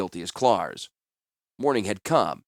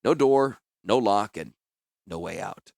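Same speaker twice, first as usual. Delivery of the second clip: usual speaking voice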